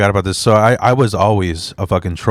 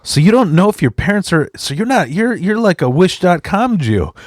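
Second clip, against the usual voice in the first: raised, high teasing jab; mock gotcha; 8 semitones higher than usual. Teasing the drunk guy, higher. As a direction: go in for the kill, grinning.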